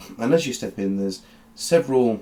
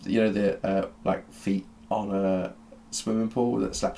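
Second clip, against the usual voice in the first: usual speaking voice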